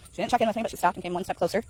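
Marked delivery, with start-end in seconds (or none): none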